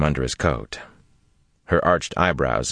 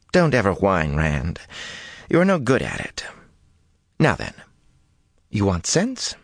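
lightly voiced young woman, higher, building; a hint of Min, threatening playfully; Min, teasing then defiant; 7 semitones higher than usual. A narrator's reading. Min's voice in narration.

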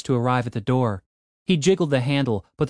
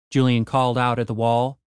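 ordinary delivery

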